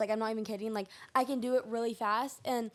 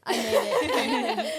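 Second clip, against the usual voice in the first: high, slow, giggling brag; laughing; 11 semitones higher, 6 dB louder, much slower than usual. Real, not acted. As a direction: giggle it proudly. Proud of logo, laughing.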